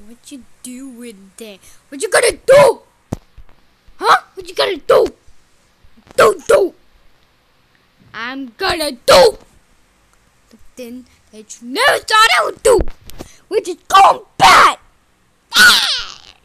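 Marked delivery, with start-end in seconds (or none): 1.9-14.8 high, loud punchy challenge; confrontational. Pretend. Threatening an onlooker to go bad, loud challenge. 15.6-16.3 very high, loud shriek; sudden piercing "Ah"; tantrum scream. Pretend. Screaming after threatening to go bad, high shriek.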